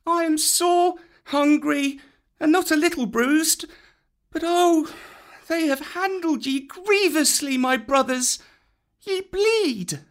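put-on very high boy's voice; a worried child speaking; Mowgli the boy. A narrator's reading. Mowgli, bruised, fretting over his friends' wounds.

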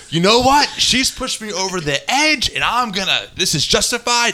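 high-pitched, self-righteous mock rant; retold angry outburst. Host re-enacts kicking down his sister's door, mock anger. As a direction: play up your old fury, pitched high. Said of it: "I was pretty angry".

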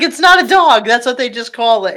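a hammed-up excited correction; mock Giselle exclaiming. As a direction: burst out correcting him, high. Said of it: "Yeah, she's like".

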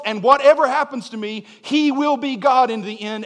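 defiant preaching that builds; preaching at full force. Acted.

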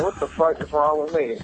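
normal talking voice

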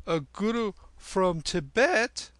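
put-on high, loud boyish question; high-pitched incredulous youngster; boy Kim. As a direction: ask amazed in a high boy's voice.

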